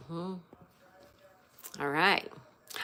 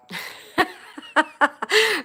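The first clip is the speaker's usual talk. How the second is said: laugh; 8 semitones higher, 6 dB louder than usual. Real, not acted. Amused by Richard Gere name.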